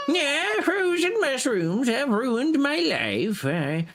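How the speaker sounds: sad crybaby lad. Acted. Player hamming up a miserable sad lad while foraging. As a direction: whine it like a self-pitying sad lad.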